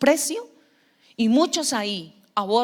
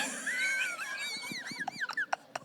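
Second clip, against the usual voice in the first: burst of laughter; 11 dB quieter than usual. Real, not acted. Laughter after the confession.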